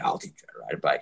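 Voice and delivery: low, soft creepy uncle voice; a sly, hushed creepy uncle. Retold or imitated. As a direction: lurk like a creepy uncle offering help. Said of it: "creepy uncle is like".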